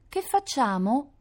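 high, slow, drawn-out whine; whining little girl. A narrator's reading. Little sister asking brother for help, whining. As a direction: whimper it like a worried child. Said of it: "piagnucolò Rita".

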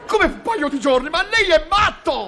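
high, incredulous outburst; rising indignant alarm; outraged disbelief. Acted. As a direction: protest as if he were insane. Outraged at days of waiting.

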